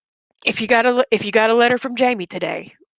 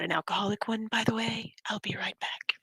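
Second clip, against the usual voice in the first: soft whispered aside; whispering; 10 dB quieter than usual. Real, not acted. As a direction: drop to a secret whisper.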